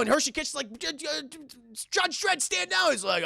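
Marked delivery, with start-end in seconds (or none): 0.8-2.9 high-pitched stammering plea to stop; stammered protest. Retold or imitated. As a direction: imitate a flustered officer, stuttering and pitched up. Voices Hershey urging Dredd to stop.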